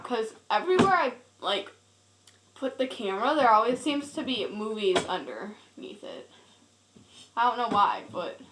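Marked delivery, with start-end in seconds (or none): none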